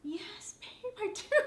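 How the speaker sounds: wife swooning as she answers her husband; swooning reply. Retold or imitated. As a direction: melt like a smitten girl. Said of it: "makes me say".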